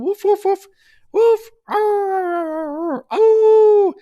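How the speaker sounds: high, loud yappy woofs; dog barking. Acted. Tarot reader imitates the card's little dog, loud yapping.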